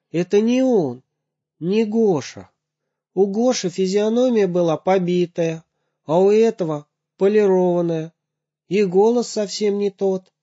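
mother's voice. Acted. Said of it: "возразила мама".

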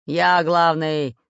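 loud, slow growl; slow, forceful pirate growl. A narrator's reading. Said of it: "рычал Буль-Буль".

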